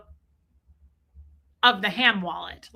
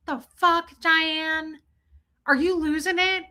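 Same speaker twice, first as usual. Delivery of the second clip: incredulous retort; 6 semitones higher than usual. Retold or imitated. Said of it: "I was like".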